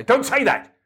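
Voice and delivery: mock telling-off in a high mum voice; scolding mum. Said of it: "Mum would say".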